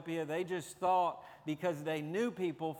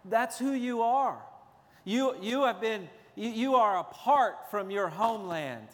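higher, louder preaching drive; full-force preaching; 4 semitones higher, 4 dB louder than usual. Real, not acted. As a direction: preach it with rising urgency to the congregation. Preaching on First Peter.

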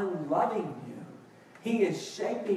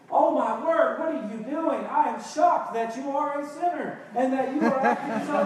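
higher, louder hammed-up outrage; mock shocked reaction; 5 semitones higher, 5 dB louder than usual. Parent overreacting to a child's fit, mock shocked. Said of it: "It's not just reacting".

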